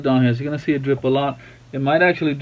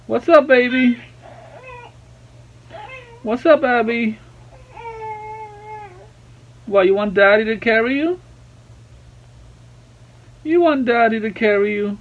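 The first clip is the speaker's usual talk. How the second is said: higher-pitched daddy talk to an infant; 7 semitones higher than usual. Real, not acted. Daddy checks on crying Abigail, high baby talk.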